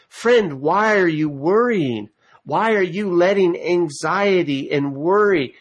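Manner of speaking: rising pulpit exhortation aimed straight at friend; fervent preaching. Acted. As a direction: preach it out, pleading with the flock.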